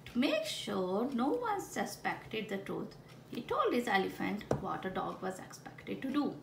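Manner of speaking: ordinary delivery, nothing marked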